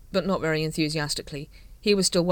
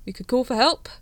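uncertain; 7 semitones higher, 5 dB louder than usual. Acted. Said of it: "said the captain uncertainly".

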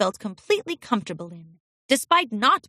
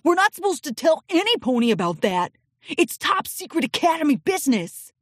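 put-on high, anxious young colt; urgent colt voice; 6 semitones higher than usual. A narrator's reading. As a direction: warn her in a hurry. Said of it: "urged a yellow colt".

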